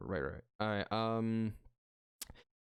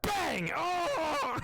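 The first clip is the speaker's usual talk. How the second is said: gunshot bang; 14 semitones higher than usual. Acted. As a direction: shout the bang, then yelp high. DM voices the gunshot and recoil.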